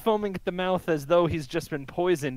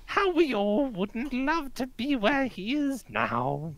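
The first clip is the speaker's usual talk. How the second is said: put-on old woman; 9 semitones higher than usual. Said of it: "the old woman kinda just takes her cart and says".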